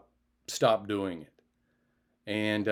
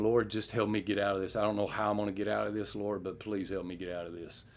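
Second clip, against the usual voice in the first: soft, hurried plea to the Lord; praying under his breath, rushing the words; desperate prayer; 4 semitones lower, 8 dB quieter than usual. Retold or imitated. Man praying to God for rescue, hushed and hurried. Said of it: "I was saying".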